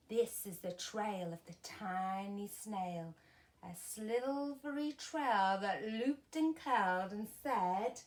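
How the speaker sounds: plain reading voice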